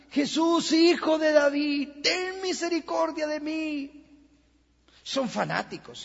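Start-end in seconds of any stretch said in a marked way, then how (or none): 0.1-4.0 higher and louder, pleading call; crying out. Retold or imitated. Blind beggar crying out to Jesus: loud pleading call. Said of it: "grita y clama".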